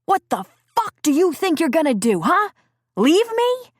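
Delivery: screaming in rage, pitch soaring; furious shouting. Acted. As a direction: yell it at her, out of control.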